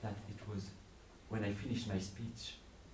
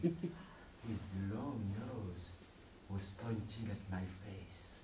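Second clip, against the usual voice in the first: plain reading voice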